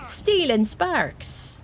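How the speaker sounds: a hint of Irish brogue, drawn out; slight Irish accent. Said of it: "making it a bit Irish when I do that voice".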